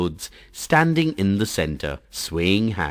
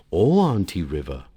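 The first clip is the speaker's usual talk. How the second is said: ordinary delivery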